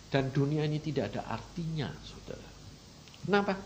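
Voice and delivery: usual speaking voice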